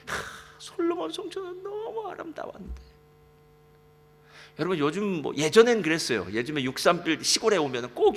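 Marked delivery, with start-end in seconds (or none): 0.1-2.8 put-on lamenting old men. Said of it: "노인들이 울기 시작해요".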